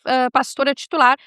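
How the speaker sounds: normal talking voice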